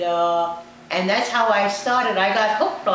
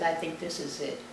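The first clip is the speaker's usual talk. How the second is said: very soft, subdued quote; retold quiet resignation; 3 semitones lower, 11 dB quieter than usual. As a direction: softly voice a patient accepting the end. Retold dying patient giving up.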